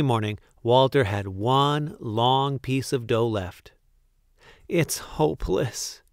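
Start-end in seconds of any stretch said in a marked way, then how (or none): 4.7-6.0 higher, understated wail; despairing cry. A narrator's reading. Baker fails after a night's work, despairing cry. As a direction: give up in despair. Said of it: "he cried".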